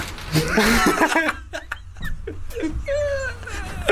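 long, high-pitched helpless laugh; laughing fit. Real, not acted. Co-host cracking up at the joke, laughing fit. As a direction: crack up at length, squealing high.

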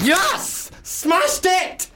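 very high, crowing celebration; triumphant. Real, not acted. Podcaster celebrating his Boston accent, triumphant.

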